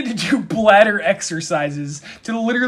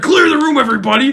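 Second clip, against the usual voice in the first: high, loud heroic command; hammed-up hero voice; mock heroic rescuer; 8 semitones higher, 5 dB louder than usual. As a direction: announce it like a superhero.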